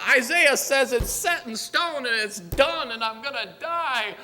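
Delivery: high, loud mock wailing; hammed-up tearful whine; pitiful whining. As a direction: imitate a sobbing man bemoaning his fate. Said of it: "pitiful state of whining".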